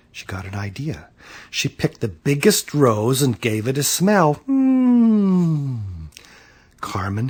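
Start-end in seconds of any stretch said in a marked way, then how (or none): 0.1-1.1 low, near-whispered line; very soft, confiding; hushed narration. A narrator's reading. Secretive moment in the story. 4.5-5.8 high, long falling "mmm"; drawn-out appreciative hum; savoring hum. A narrator's reading. Imitates smelling the rose.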